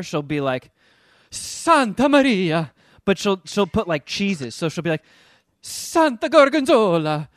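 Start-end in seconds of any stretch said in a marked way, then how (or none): 1.3-2.7 loud, slow mock-Italian exclamation, pitched up; exasperated Italian girl. Little girl when something exacerbates her, mock-exasperated Italian accent. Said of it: "anytime something exacerbates her". 5.6-7.3 high, loud, drawn-out mock-Italian oath; exasperated Italian girl. Little girl swearing by cheeses, mock-exasperated Italian accent. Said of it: "anytime something exacerbates her".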